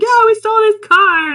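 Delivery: high loud mock voice. Deadbeats boasting.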